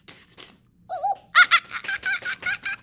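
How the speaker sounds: hushed tss, then high hoots; squealing ooh-ah monkey imitation; monkey chatter. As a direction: mimic monkeys chattering back. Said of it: "monkeys only stomped their feet back".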